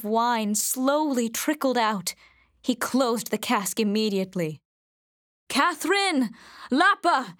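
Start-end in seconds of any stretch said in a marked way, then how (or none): 5.5-7.4 high, louder call of alarm; alarmed cry. A narrator's reading. Father calling wife and daughter to the empty cask, alarmed cry. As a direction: cry out for the family in dismay. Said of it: "he cried".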